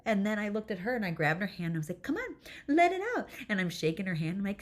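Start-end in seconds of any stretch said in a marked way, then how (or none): 2.0-3.3 high, loud egging-on; excited urging. Retold or imitated. Mom urging her daughter to make noise, excited egging-on. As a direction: cheer them on, pitch way up.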